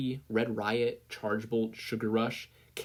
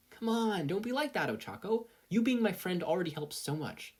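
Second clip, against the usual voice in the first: comforting; 9 semitones higher than usual. Acted. Izuku comforting Ochako.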